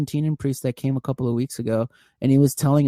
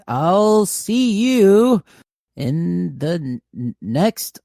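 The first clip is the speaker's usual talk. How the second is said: drawn-out sign-off; 5 semitones higher, 4 dB louder, much slower than usual. Pretend. Slow, drawn-out sign-off attempt.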